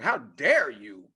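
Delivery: mildly indignant retold protest. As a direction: imitate a son taking offense. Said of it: "he got indignant".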